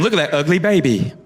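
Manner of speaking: lightly voiced gossip with a sweet tag; hint of Alabama church lady; catty Southern lady. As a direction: insult then soften it sweetly. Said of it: "women in Alabama".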